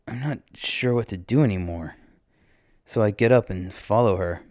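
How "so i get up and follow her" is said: ordinary delivery, nothing marked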